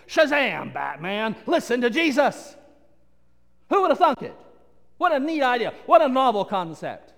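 sarcastic astonishment. Real, not acted. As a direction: ham up the obvious as a big discovery.

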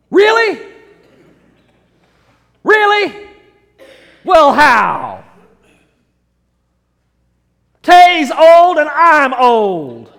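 loud, high-pitched incredulous questioning; hammed-up disbelief, nearly shouted; Jensen. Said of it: "I can kinda hear Jensen over here saying".

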